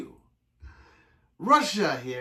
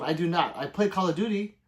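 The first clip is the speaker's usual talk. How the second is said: ordinary delivery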